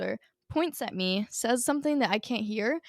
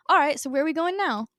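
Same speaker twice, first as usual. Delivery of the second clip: cheerful; 6 semitones higher, 5 dB louder than usual. Retold or imitated. Ben walks up cheerfully.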